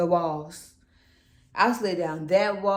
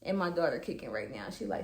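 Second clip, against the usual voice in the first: quiet aside; 7 semitones lower, 11 dB quieter, faster than usual. Real, not acted. Daughter kicking in womb.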